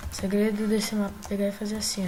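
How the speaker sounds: normal talking voice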